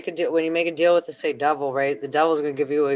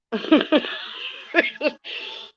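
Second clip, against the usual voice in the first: giggling laugh; 13 semitones higher than usual. Real, not acted. Giggling at the spirits' chatter.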